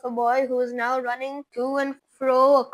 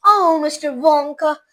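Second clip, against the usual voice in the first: wailing; 2 semitones higher, 8 dB louder than usual. A narrator's reading. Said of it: "wailed Missus T V".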